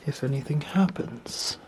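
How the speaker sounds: barely voiced, tense; nervous night vlog whisper. Real, not acted. Ghost hunt, hushed.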